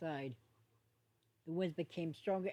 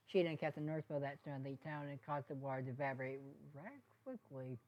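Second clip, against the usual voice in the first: ordinary delivery, nothing marked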